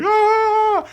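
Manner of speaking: sudden high, loud scream of fright; startled scream. Retold or imitated. Boyfriend sees his girlfriend's body fall forward, screaming. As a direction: yelp in panic at the sight. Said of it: "And he's like".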